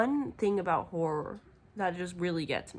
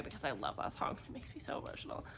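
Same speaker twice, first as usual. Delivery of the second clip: soft whisper; whispered aside; 6 semitones lower, 11 dB quieter, much faster than usual. Real, not acted. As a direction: breathe it out like a secret.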